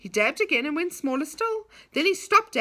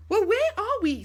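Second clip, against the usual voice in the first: in wonder; 9 semitones higher, 4 dB louder than usual. A narrator's reading. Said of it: "said Dave in wonder".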